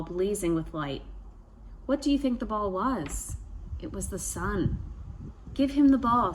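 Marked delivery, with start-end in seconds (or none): 5.5-6.3 louder commanding chief voice; trickster tale voices. A narrator's reading. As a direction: call it out like a proud chief. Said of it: "said Sky Chief".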